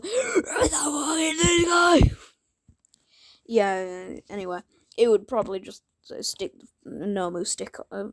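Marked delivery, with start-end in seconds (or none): 0.0-2.1 high, loud, drawn-out mock screech; mock outraged scream. Streamer defies YouTube's rule on swearing, hammed-up shout. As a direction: scream it out slowly in a strained put-on voice. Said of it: "Shigaraki's crusty ass voice".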